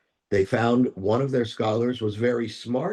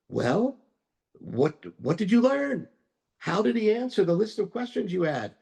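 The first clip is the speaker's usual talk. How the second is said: much higher, eager and curious; eager questioning; 8 semitones higher than usual. Acted. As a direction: villagers crowding in for news. Villagers eager for answers.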